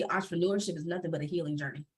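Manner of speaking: ordinary delivery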